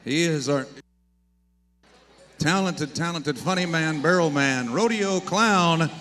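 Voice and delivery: building hype announcer introduction; announcer intro. Acted. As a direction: build it up to a big name. Announcer introduces rodeo clown.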